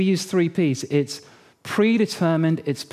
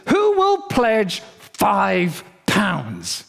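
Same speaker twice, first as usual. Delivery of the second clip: slow, drawn-out call for pledges; preacher's appeal; 3 semitones higher, much slower than usual. Retold or imitated. Father appealing to his congregation for pledges, slow booming-preacher imitation. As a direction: ring it out slowly, stretching the amount.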